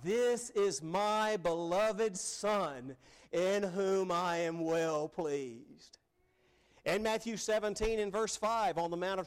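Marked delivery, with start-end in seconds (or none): 0.0-6.0 slow, solemn proclamation from God; God's measured, resounding declaration; voice from heaven. Acted. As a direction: proclaim it grandly, stretching every word. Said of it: "a voice came from heaven that said".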